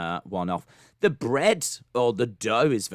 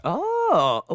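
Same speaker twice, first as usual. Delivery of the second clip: surprised oh; 12 semitones higher, 3 dB louder than usual. Real, not acted. Surprised at pictures.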